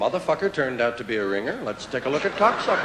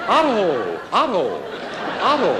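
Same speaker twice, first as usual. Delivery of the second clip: mock yell; 3 semitones higher than usual. Comic pretending to yell his own name, calling out. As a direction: yell the name across a field.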